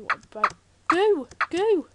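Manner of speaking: put-on high babbling baby talk. Gamer finding slime, cooing like a baby. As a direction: coo goo like a toddler. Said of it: "I sound like a little baby".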